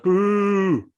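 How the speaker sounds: loud, high mock boo; crowd boo. The crowd booing another no contest, loud mock boo.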